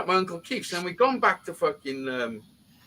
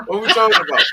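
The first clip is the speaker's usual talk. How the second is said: asked through a real laugh, louder; 3 semitones higher, 9 dB louder, faster than usual. Real, not acted. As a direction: laugh while losing the thread. Laughing, losing the story thread.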